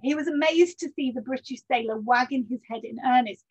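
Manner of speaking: ordinary delivery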